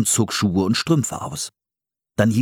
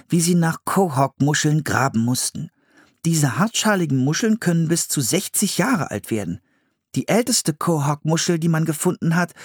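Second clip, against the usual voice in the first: put-on higher boy voice reading from a book; boy reading aloud; 8 semitones higher than usual. A narrator's reading. Philipp reads the book to his sister, high and careful. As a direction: read it like a kid reciting facts.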